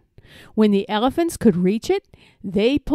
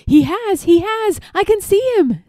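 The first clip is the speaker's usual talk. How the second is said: high, louder excited outburst; 9 semitones higher, 4 dB louder than usual. A narrator's reading. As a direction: announce an eager sighting. Elephant thinks it spots Wilbur.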